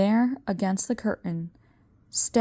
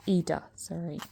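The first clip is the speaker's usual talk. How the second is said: hushed; 7 dB quieter than usual. A narrator's reading. Aside to audience.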